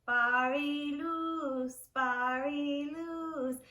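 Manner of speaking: Bari luz drawn out slowly; slow Armenian. Shown as a demonstration. Teacher slowly modeling Armenian greeting for students. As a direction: stretch out Bari luz for learners. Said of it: "Let's do Armenian".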